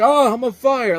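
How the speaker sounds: high, loud imitation of bad acting; mock yelling. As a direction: yell it like an unconvincing actor on fire. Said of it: "yelling because he's on fire".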